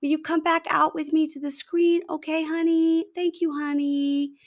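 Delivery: very high, careful placating voice; Nicole. Retold or imitated. Wife asking husband back to the screen, mock sweetness. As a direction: imitate a sugary, pleading spouse. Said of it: "Nicole's saying to Mahmoud".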